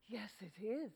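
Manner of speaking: hushed, breathy aside; whisper. Acted. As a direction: whisper it slyly to yourself. Sly aside while tricking friend.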